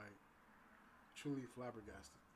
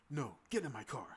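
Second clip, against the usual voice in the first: loud, sharp command; bossy order; 10 dB louder than usual. Retold or imitated. Date barking at him to get in her car, loud.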